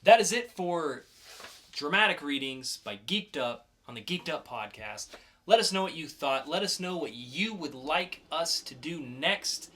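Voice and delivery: hyped podcast segment announcer, building up. Acted. Host hypes the audience into the next segment, showman announcer.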